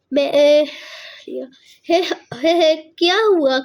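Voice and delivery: startled uncle stopping her; flustered cry, slowing down; flustered calling out. Acted. Uncle catches the maid in panic. (گھبرا کر روکنا)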